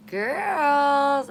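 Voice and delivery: high calling to the hens. Retold or imitated. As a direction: sing out to the chickens. Calling chickens in story.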